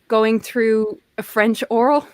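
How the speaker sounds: usual speaking voice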